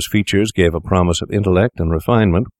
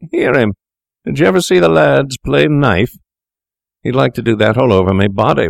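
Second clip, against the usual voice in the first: higher, louder voice for the coarse stranger; mock him like a vulgar bully, pitched up; taunting stranger; 7 semitones higher, 3 dB louder than usual. Coarse stranger jeering at Macfarlane over drinks, higher taunting voice.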